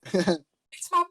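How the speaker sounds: lightly done high Goldblum voice; a quick stab at Goldblum; Jeff Goldblum impression. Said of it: "Jeff Goldblum in, uh, Thor Ragnarok".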